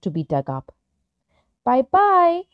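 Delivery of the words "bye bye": high, sing-out farewell; calling out. A narrator's reading. Little tree to his friends as he leaves, calling out. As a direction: call it high, without real volume. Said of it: "he shouted to his friends".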